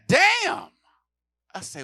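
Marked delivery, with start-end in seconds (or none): 0.1-0.7 high, loud shout; retold yell. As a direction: blurt it out. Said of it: "Tony yell out".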